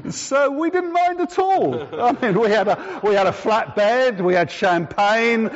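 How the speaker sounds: high, giggly gloating through a laugh; laughing smugly. Real, not acted. Preacher boasting of the flat bed, laughing. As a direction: laugh it out, savouring the luxury. Gleeful about the business upgrade.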